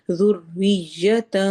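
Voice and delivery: ذریتاً stretched very slowly, ending nun hidden in the nose; slow recitation, تاں nasal and hidden. Shown as a demonstration. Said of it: "نون کی آواز کو چھپانا ہے".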